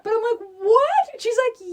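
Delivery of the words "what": high, loud disbelieving what; stunned squeal of disbelief; shocked what. Retold or imitated. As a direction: blurt it out in total disbelief. Shocked disbelief at daughter's news.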